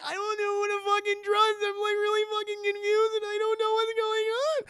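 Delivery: high, loud, tearful mock panic; hammed-up confused crying. Jerry confused and scared, hammed-up crying voice. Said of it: "he's like".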